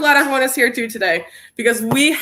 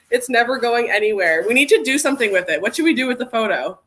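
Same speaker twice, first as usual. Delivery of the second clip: ordinary delivery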